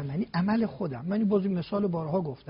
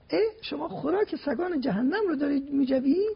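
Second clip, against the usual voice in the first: higher, indignant, scolding tone; mock shocked rebuke; 6 semitones higher than usual. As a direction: scold him as if shocked at what he's chewing. (توبیخ نمایشی با لحن متعجب و بلندتر)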